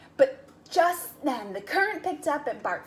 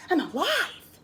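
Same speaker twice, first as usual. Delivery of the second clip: higher, relieved exclamation; amazed cry; 7 semitones higher, 3 dB louder than usual. Acted. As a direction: gasp it out in disbelief. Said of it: "he cried".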